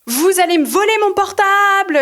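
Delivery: loud, high accusing cry; mock yelling. Yelling at the repairman from the street, high and loud. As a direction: shout it to the street so everyone hears. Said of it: "je commence à dire".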